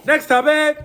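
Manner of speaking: high, loud shout to move the show on; shouted announcement. Real, not acted. Host announces the next topic.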